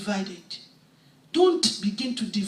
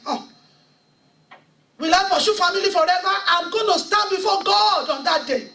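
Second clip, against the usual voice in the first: raised, louder preaching voice, laughing in places; high, loud revival sermon delivery; full-force preaching; 5 semitones higher, 4 dB louder than usual. Acted. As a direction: preach your testimony with rising fire. Impassioned testimony, preaching hard.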